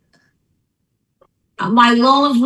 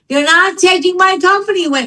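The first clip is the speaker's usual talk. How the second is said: higher, half-acted tearful yell; retold screaming and crying; 7 semitones higher than usual. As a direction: replay her desperate outburst at the lender. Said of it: "I was screaming and crying".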